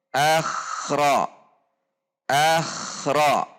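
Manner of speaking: "akhra" with the kh running straight into ra, no break; flowing kh demo. Shown as a demonstration. Teacher showing the correct "akhra", no gap. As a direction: glide kh into ra in "akhra".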